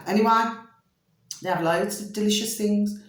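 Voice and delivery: usual speaking voice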